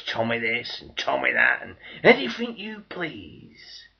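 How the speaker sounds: loud mock-eager praise; fawning call. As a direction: call it out like a crowd suddenly eager. Civilians fawning in wartime.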